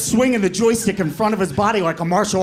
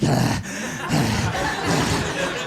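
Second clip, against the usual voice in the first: winded gasp; 6 semitones lower, much slower than usual. Retold or imitated. Winded brother gasping after throw.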